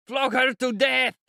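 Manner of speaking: put-on high, drawn-out drunken roar; savage cry. A narrator's reading. Mikolka urges the crowd on, savage high cry. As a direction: howl it out with cruel relish. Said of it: "cried Mikolka".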